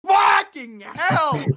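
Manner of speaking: loud, high, very slow amazed cry; mock peasant outburst. Medieval peasants hearing about plague cures, loud slow astonishment. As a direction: belt it out like a stunned peasant. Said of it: "And they're like".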